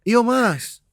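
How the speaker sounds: put-on high, falling Ambonese accent; lilting Ambonese reply; Ambonese uncle. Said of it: "om gue akan ngomong".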